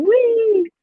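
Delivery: put-on high toddler "wee"; lightly voiced child asking; high little call. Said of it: "He was saying".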